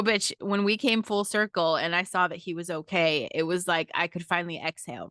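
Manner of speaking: ordinary delivery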